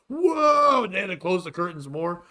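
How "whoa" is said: high, loud drawn-out crowd "whoa"; mock collective gasp of "whoa"; imitated crowd reaction. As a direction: whoop like a shocked concert crowd. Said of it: "The whole crowd goes".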